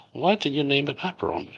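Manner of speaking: usual speaking voice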